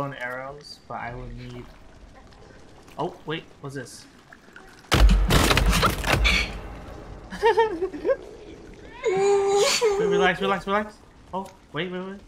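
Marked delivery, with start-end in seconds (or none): 4.9-9.0 shrieking laugh. Real, not acted. Panicked laugh at monster. 9.1-12.2 panicked. Real, not acted. Panic in game.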